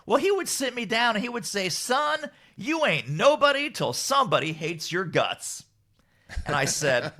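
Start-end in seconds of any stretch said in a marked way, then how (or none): 0.1-5.6 imitated Cajun grandpappy's saying, pitched high at first; rough Cajun accent. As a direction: loose Cajun accent, passing on folksy wisdom. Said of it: "had this amazing Cajun accent".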